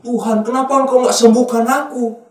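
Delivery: high, loud mock lament; blaming God. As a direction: cry out like a sick believer feeling abandoned. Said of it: "nyalain Tuhan".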